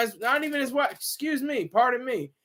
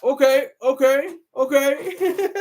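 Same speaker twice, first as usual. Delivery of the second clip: lightly voiced, louder host backing down with "okay"; imitating the host; 5 semitones higher, 4 dB louder, slower than usual. Imitating the host backing off after the comeback, a light mock voice. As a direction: give a mock, placating "okay, okay". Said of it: "Mister Terrain was like".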